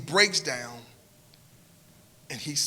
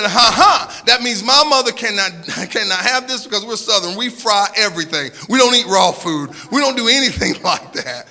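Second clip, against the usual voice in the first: loud, higher, with laughs breaking through; grasping, laughing outburst; nervous laughing denial; 6 semitones higher, 9 dB louder than usual. Retold or imitated. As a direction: laugh it off in disbelief, loudly. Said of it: "I knee-jerk response and said".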